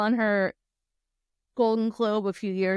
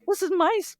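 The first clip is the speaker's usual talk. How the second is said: high-pitched mock protest of a cornered fan; startled audience member; 9 semitones higher, faster than usual. Woman in the audience to singer on her lap, mock protest. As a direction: protest as the flustered woman being crowded. Poor lady leaning away from [name].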